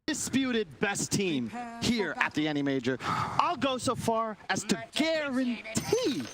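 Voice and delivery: high, drawn-out caster hype; hyped prediction. Real, not acted. Caster backing a team at the grand finals, hyped prediction. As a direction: proclaim it high, stretching the last words.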